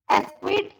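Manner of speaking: एक्विट said as "es-kwit", with an s; mispronounced acquit. Shown as a demonstration. Teacher contrasting two readings, एक्विट as "es-kwit". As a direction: put an s before the kw in एक्विट. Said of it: "ऐसे नहीं बोलेंगे हम".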